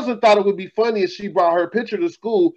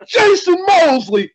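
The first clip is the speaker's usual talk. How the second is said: yelled name down a hallway; retold yell; 9 semitones higher, slower than usual. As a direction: shout the full name, high and slow. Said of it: "calls my whole goddamn government out".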